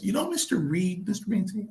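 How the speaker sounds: high-pitched impression of the boss; mimicked boss's question, high voice; Chief's manner. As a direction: imitate the chief, pitched well up. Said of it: "That's the way Chief was".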